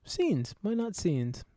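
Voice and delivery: lightly faked British accent; silly British accent. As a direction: put on a mock British voice, then drop it. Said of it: "you try to do a, a British accent for a bit".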